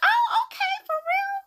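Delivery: very high-pitched squeaky reply; squeaked, questioning acknowledgment; retold surprised reaction. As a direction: react in a tiny, sky-high voice. Retold reaction to friend's concert news.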